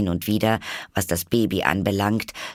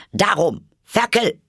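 higher, louder sudden snap; vehement; 12 semitones higher, 5 dB louder than usual. A narrator's reading. Duchess snapping fiercely at the sneezing baby. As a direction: bark it at the baby. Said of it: "mit solcher Heftigkeit".

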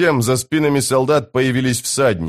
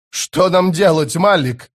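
slow, high-pitched anxious question; helpless plea; 7 semitones higher, slower than usual. A narrator's reading. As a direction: plead helplessly for guidance. Panicked commander begs for guidance. (беспомощная мольба)

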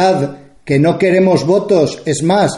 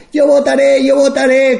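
high, mocking whiny voice, far from own tone; belittling, like a timid dwarf; mocking little man voice; 8 semitones higher than usual. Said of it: "como un enanito". (voz burlona de enanito)

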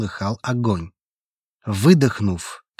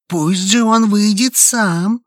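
slow roaring, higher and louder; 10 semitones higher, 4 dB louder, slower than usual. Acted. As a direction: roar it down at the crowd. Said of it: "— взревел голос". (ревущий голос)

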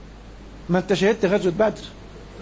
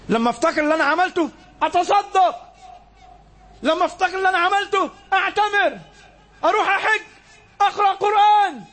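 stack each deed higher than the last; building sermon list; 10 semitones higher than usual. Acted. Preacher urging the congregation to repent, rising to a cry.

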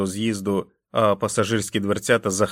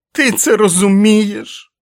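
much higher and louder, slow raging yell; furious shouting; 12 semitones higher, 10 dB louder, slower than usual. Acted. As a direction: scream at him with each blow. Jack beating and yelling at wolf. (несамовитий лютий крик)

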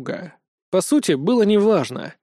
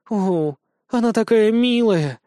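drawn-out, higher-pitched cooing; gushing outburst; 5 semitones higher, slower than usual. A narrator's reading. Rudolfina blurts it on seeing Vesnushka, gushing. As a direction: gush slowly over something adorable. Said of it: "вырвалась у Рудольфины".

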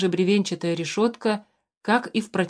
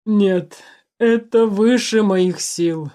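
moaning; 2 semitones higher, 5 dB louder, much slower than usual. A narrator's reading. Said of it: "простонал".

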